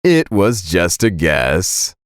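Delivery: slow, low, smug reply; sly dad, taking his time; grinning gloat. Acted. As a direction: draw it out low with a grin. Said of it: "a big grin appeared on his face".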